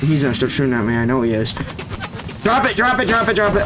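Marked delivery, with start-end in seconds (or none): 2.4-3.6 high, rapid pleading at the enemy; urgent pleading. Real, not acted. Gamer begging an enemy in a fight, high frantic plea. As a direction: beg him to drop it, pitch way up.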